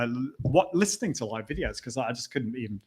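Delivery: ordinary talk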